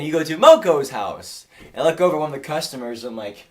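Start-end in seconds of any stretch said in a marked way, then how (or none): 0.0-1.5 louder, lightly put-on Chinese accent; insistent mock Chinese accent; imitated Chinese owner. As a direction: repeat it firmly, a touch Chinese-accented. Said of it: "And she said again".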